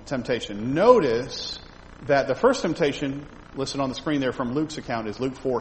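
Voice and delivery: ordinary talk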